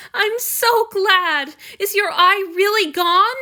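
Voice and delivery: high, loud, slow and overjoyed; tearful relief. Acted. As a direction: gush with relief, then blurt the question. Hanyu tearful, relieved then blurting.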